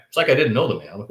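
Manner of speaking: usual speaking voice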